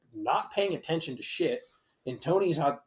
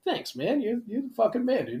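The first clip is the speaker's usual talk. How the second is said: higher-pitched, gushing gratitude; effusive thanks; 9 semitones higher than usual. Retold or imitated. Driver thanking the officer who cut his ticket, gushing. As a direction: pour on the relieved thanks. Grateful driver let off lightly.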